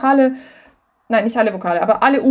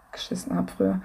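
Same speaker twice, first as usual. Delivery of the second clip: breathy whisper, much softer; whispered aside; 10 dB quieter than usual. Real, not acted. Admitting to the guest how careless old songs were, whispered. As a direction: let it slip out under the breath. Confessing a sloppy old habit.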